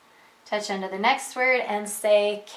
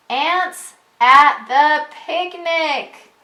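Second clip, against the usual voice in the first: slow, loud, higher title read; read aloud to children; 4 semitones higher, 6 dB louder, much slower than usual. Acted. Teacher reading a title to a young reader, slowly.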